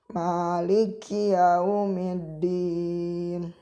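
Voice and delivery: yaumiddin slow, the dd held a beat before the vowel; held doubled d. Shown as a demonstration. Teacher demonstrates the held tasydid to students, very slow. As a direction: press and hold the doubled dal in yaumiddin. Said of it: "Agak ditahan".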